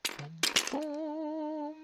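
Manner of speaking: squeaky high boom boom; high sound effect boom. Acted. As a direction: pop the booms high and soft.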